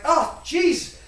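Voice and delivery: high, startled yelp; a high yelp of pain and alarm; startled oh jeez. Retold or imitated. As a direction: jump as the hot rain hits. Hot embers raining down.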